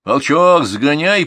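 loud, high command to a dog; shouted order. A narrator's reading. Grandfather sends the dog after cattle, ordering loudly. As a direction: call the dog out to work. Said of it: "приказал Николай".

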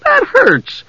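squealed, very high complaint; pained protest. Acted. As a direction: squeal it in indignant pain. Usha protests the painful grip.

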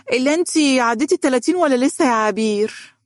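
high, prying auntie voice; nosy older woman. Acted. Nosy guest prodding Abeer about her age, high and prying.

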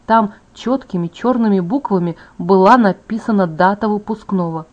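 ordinary delivery

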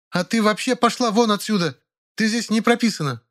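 high, furious retort; angry shout. A narrator's reading. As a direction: drive her out with words. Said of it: "крикнула ей в ответ". (гневный выкрик)

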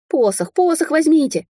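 high, urgent raccoon cry; agitated high-pitched shouted plea; shouting. A narrator's reading. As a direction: call out frantically as the raccoon. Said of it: "кричал енот".

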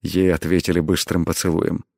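usual speaking voice

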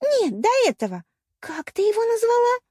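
very high, incredulous questioning; shocked demand. A narrator's reading. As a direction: demand it in scandalised disbelief. Shocked jealous mermaid demands answer. (потрясённый ревнивый вопрос)

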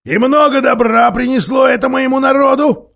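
loud, high bitter snap; snapping back. A narrator's reading. Dwarf snaps at the badger about his people, loud bitter snap. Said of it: "огрызнулся Никобрик".

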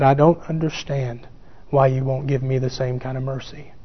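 a quiet, tender imitation of a hurt wife; gentle godly spirit. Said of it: "In a very godly spirit".

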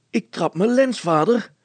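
put-on high, loud, strained boy; panting. A narrator's reading. Hannes pedalling to the utmost tells father, panting. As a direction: cry out short of breath from pedalling. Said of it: "hijgde hij".

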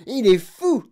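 high, loud outcry; shouted accusation. A narrator's reading. Parents howling about the boatman's sanity, shouting. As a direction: yell it in dismay. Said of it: "crièrent-ils".

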